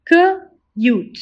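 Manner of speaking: K-ute split into onset and long vowel; segmented. Shown as a demonstration. Teacher sounding out K-ute for the class. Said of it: "Repeat after me".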